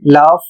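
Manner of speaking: लाफ said as laaf, ending on a clear f; demo pronunciation. Shown as a demonstration. Teacher demonstrating लाफ to students. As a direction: say लाफ with a long aa and a crisp f at the end. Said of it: "इसका अर्थ होता है हंसना".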